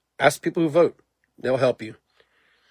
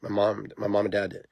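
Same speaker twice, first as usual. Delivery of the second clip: usual speaking voice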